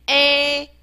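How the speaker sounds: a as a long, open, level "eh"; 字母发音示范. Shown as a demonstration. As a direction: hold the letter sound a like "eh" on one pitch. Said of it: "我们念a". (平稳拉长地念成“e”)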